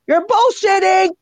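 high, teasing exclamation; mock call-out. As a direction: sing out the accusation playfully. Voicing blunt name for flattery.

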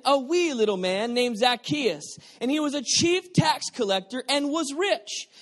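loud, lifted storytelling voice; animated Bible reading; dramatic scripture reading. Real, not acted. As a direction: read it like an exciting story.

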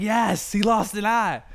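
retold cheer. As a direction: celebrate like a fan in the cinema. His own cheer remembered.

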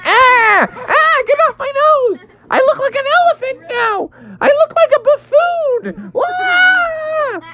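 very high, squealing frog in pain; hammed-up squealing distress; panicked yelping. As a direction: wail in a cartoon falsetto. Frog's nose bitten by eel.

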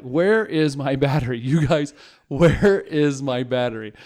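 mock-angry dad yelling for his battery; exasperated rant. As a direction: call it out across the house, fed up. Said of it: "I kind of ranted about the house".

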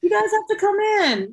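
high, pleading "you guys have to come in"; excited invitation; eager insistence. Real, not acted. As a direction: urge them warmly. Insisting they visit.

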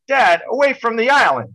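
high, loud retold shout; yelling to dad. Yelling answer to his dad.

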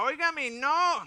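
high, loud, slow protest of a choked servant; a hammed-up plea to be let go; pleading cry. Choked servant protests to his attacker, mock panicked cry. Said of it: "Y el otro decía".

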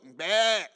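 imitated goat bleat; a quick goat's bah. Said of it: "All kind of animal sounds".

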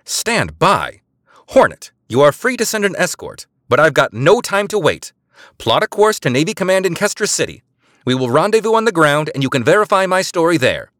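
high, loud official command; spy in official voice refusing to wait. A narrator's reading. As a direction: speak with cold authority. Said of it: "Eliza's official voice came back".

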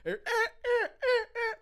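high repeated alarm bleats; imitated car alarm. Storyteller mimics his car alarm going off, high repeated bleats. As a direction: honk out short, high alarm pulses.

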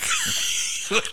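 squeaky suppressed giggle; high stifled giggle. Real, not acted. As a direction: giggle you cannot hold in. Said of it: "Cannot keep a straight face".

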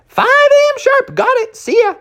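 very high, chirpy cub voice; high eager cub agreeing; squeaky young bear. Acted. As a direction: toss it off like a cheerful kid. Junior voiced in story.

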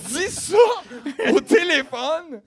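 high, laughing through words. Real, not acted. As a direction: giggle it out. Laughing at the story.

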